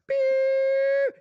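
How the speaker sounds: high, sustained mock bleep; censor bleep. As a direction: hold one high beep like a censor tone. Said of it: "I bleeped out the name".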